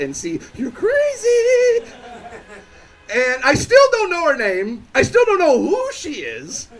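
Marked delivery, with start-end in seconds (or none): 0.5-5.0 mock freaked-out squeal. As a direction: stretch it out way up high, hammed-up. His panicked inner thought, sung out high.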